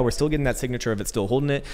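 ordinary delivery

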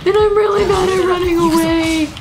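high whiny wail while fleeing; panicked wail. Real, not acted. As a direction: cry it out in helpless alarm.

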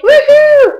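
a high, loud, hammed-up woohoo; mock party whoop. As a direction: cheer like a fake party animal.

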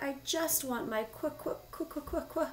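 high plea into stutters; plead, then chatter with cold; acted freezing queen's stammer; crying out, shivering. A narrator's reading. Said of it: "the queen cried".

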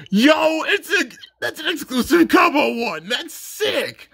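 high, loud excited yell; excited shout. Real, not acted. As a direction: shout it out thrilled. Excited at exclusive card.

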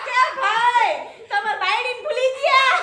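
laughing as she shouts it out; the man calling out that he forgot his wife; retold call across the pump. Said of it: "બૂમ મારી".

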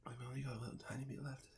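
fast breathy whisper through the burn; strained whisper. Real, not acted. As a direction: whisper it, mouth on fire.

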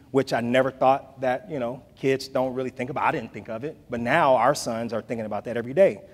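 ordinary talk

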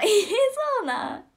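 high, loud drawn-out gasp of surprise; surprised. Real, not acted. Hearing about the blowdart, she squeals in surprise.

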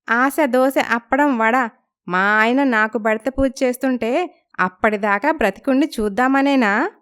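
mock-scold the old lady playfully; cheeky young girl. Lalitha teases the neighbour grandma, high cheeky voice.

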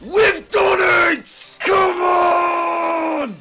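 high, loud, stretched victory shout; triumphant yell. Real, not acted. Gamer celebrating winning the match, high triumphant yell. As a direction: roar the win out.

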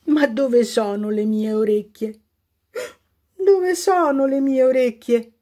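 a touch of sobbing as you ask; girl crying for her lost ears. A narrator's reading. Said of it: "piangeva Cache Mache".

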